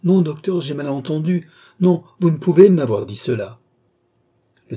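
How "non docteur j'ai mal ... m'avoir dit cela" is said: crying out, mad with grief; anguished cry. A narrator's reading. Philippe begs the doctor, sobbing out loud. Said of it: "entrecoupant chacune de ses paroles d'un sanglot".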